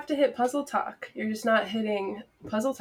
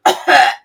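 mock cough; 3 semitones higher, 13 dB louder than usual. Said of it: "let me just take care of this".

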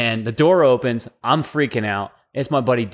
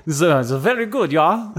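joking, put-on German accent; 3 semitones higher than usual. Joking about the German word, mock German accent. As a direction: play a pleased German with a thick accent. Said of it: "That's the German word for it".

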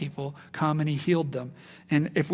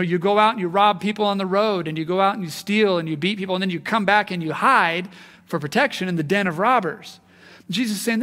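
ordinary talk

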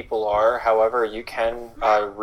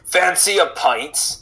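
higher, slow pub invite; old gentleman; 7 semitones higher, slower than usual. Acted. Old gentleman inviting Tyler, higher slow. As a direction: offer cheerily like an old local.

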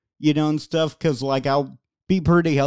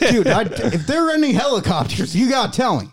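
much higher, jittery pleading voice; paranoid guard impression; 7 semitones higher, 3 dB louder than usual. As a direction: play a guard spooked by helicopters.

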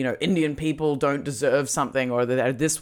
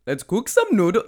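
a Swedish Chef try that slides into a mock Indian accent, a bit higher and louder; botched Swedish Chef; 8 semitones higher, 8 dB louder than usual. Host tries the Swedish Chef himself and lands in a mock Indian accent. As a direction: attempt "let's cook some noodle-" as the Chef but let it drift into a mock Indian accent. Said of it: "no, it's Indian".

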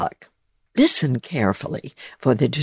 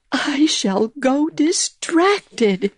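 much higher, slow, fretful mouse; scolding mouse; 12 semitones higher, slower than usual. A narrator's reading. Mouse scolding amid the mess, high and slow. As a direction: scold in despair. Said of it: "scolded Missus Tittlemouse".

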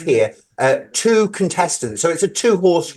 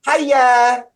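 high sing-song hiya; 8 semitones higher than usual. Shown as a demonstration. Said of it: "means, uh, like".

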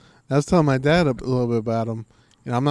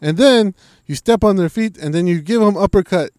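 loud fight tips; 6 semitones higher, 9 dB louder than usual. Retold or imitated. GSP listing punches, loud and high. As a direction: boom out the combo steps. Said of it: "It's like".

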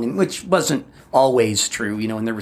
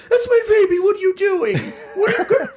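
mock outraged shriek. Mom yelling at Howard over the portrait, mock shriek. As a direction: shriek like a mother seeing her son's portrait ruined. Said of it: "my mom gets mad and goes like".

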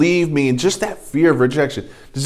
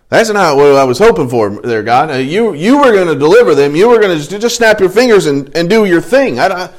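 acted complaint climbing higher; protesting; 5 semitones higher, 4 dB louder than usual. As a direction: push back at God as a put-out Moses. Moses protests to God, acted.

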